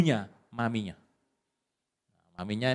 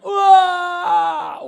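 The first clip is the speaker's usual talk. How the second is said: huge high roaring yell; wild shout; 11 dB louder than usual. Acted. Preacher acts out Esau's evening roar, full wild yell. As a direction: bellow it out like a wild hunter. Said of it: "dia kalau sore teriak".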